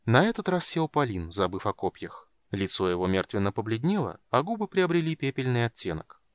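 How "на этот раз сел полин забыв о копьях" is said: plain reading voice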